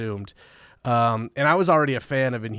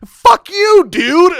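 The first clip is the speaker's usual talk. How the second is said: high, loud exasperated yell; mock furious yell; 14 dB louder, much slower than usual. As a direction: scream it at the fan. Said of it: "we're like".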